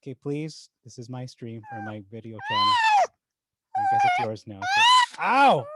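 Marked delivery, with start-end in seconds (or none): 2.5-4.8 sudden squeal. Real, not acted. Dog takes over the video. 5.2-5.7 sharp loud ow of pain; pain yelp. Real, not acted. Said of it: "Ow, it hurts".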